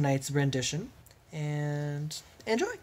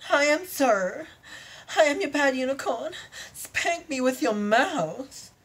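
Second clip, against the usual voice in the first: much higher, louder, eager unicorn voice; 10 semitones higher, 4 dB louder than usual. Acted. Unicorn telling her lover the safe words, high and eager. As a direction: chirp it out like a giddy unicorn.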